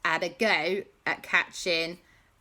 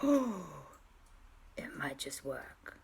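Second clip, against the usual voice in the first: awed whisper; 2 semitones lower, 7 dB quieter, slower than usual. Acted. Everyone hearing the genius plan, hushed whisper. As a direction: whisper it with cautious hope.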